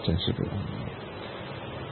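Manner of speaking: breathy, slow whisper; whispered sigh. Real, not acted. Teacher lamenting to students, whispered and slow. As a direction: let it fall away to a slow whisper. Teacher sighs over how hard it is.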